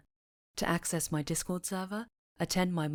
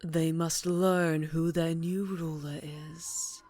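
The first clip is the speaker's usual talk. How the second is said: sinister sorcerer; slower than usual. Acted. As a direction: purr every threat like a gloating dark lord. Villain addresses captive witch.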